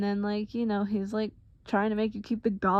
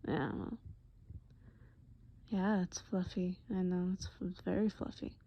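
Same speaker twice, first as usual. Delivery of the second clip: put-on soft, hushed, caring boyfriend at the dentist; tender boyfriend Eddie; 7 dB quieter than usual. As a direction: soothe her gently, half whispering. Boyfriend comforting girlfriend after dentist.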